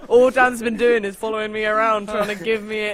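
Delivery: usual speaking voice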